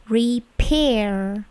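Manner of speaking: "repair" split in two, puff of air on "pair"; drawn-out "repair", P bursting; plosive P. Shown as a demonstration. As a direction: say "re-pair" slowly with an aspirated P. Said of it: "bunyi P-nya itu plosif".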